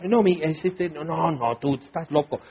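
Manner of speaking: low, mumbled brush-off; dismissive disciples. Acted. Disciples dismiss what Jesus says.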